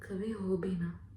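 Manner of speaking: low, near-whispered start of a painful story; hushed recollection. Acted. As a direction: begin softly, voice dropped. Starts recalling the traumatic event. (दबी, धीमी आवाज़ में)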